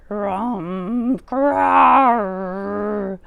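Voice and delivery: bear mutter and growl. Acted. Old Bear growling through the Hanukkah blessings. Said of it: "Old Bear muttered and growled".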